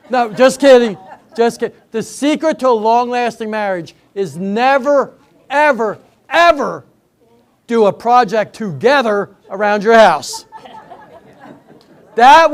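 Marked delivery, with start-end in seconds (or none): none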